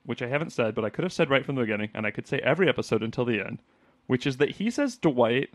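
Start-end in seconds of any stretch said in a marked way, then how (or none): 4.1-5.5 Dwight said as Duh-wight, two syllables; Dwight split into two beats, Duh-wight; Dwight stretched to Duh-wight. Shown as a demonstration. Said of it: "like, two syllables".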